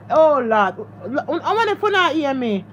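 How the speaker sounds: usual speaking voice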